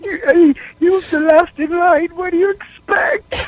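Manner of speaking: high, tearful, laughing voice; wheezing through tears of laughter; real laughing tears; crying with laughter. Real, not acted. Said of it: "here in tears with so much fucking laughter".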